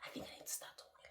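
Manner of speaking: laughing whisper. Shown as a demonstration. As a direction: whisper it with a giggle, trying her soft style. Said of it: "very soft".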